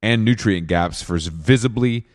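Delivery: soft, even sponsor read. Acted. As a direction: read the ad copy smoothly. Host reads sponsor ad.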